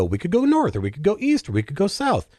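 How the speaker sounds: a hint of a sing-song girl pondering directions; playful Dorothy voice.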